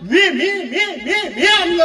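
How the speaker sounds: lightly voiced bragging rival, stuttering the repeated I; mocking a boastful rival. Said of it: "सांगतो मोठे एवढे घशान". (फुशारकी मारणाऱ्या नेत्याची हलकी नक्कल)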